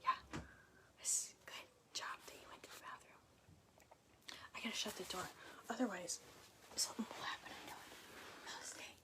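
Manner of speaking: hushed whisper, nearly voiceless. Real, not acted. Host whispering to the dog in the room, hushed. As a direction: whisper so the dog stays calm.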